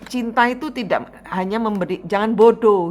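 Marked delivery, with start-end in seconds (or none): 2.0-2.9 sharp scolding. Real, not acted. Scolding client.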